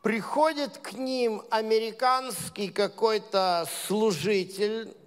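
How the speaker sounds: normal talking voice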